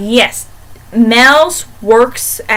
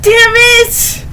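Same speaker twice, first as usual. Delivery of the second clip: much higher, louder mock curse; frustrated outburst; 10 semitones higher, 4 dB louder than usual. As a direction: spit it out like a fan cursing the plot. Reacting to room six sixty-six.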